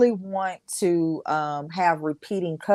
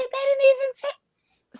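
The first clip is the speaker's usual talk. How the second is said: high surprised voice. Retold or imitated. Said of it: "I said".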